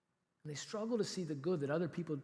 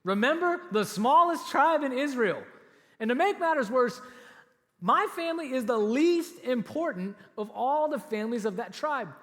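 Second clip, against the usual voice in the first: put-on a humble young man objecting, higher and louder; protesting Saul; 7 semitones higher, 7 dB louder than usual. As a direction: act out Saul shrinking from the call, voice raised. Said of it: "Saul replied".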